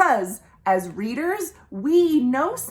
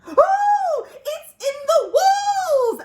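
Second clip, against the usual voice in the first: much higher, louder screech; panicked owl screech, high and loud; 5 dB louder, slower than usual. Acted. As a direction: shriek in alarm as Owl. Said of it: "Owl screeched".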